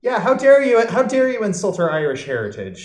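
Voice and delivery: loud, high mock indignation; mock outrage. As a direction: act hammed-up offence on her behalf. Mock outrage at teasing about Maddie.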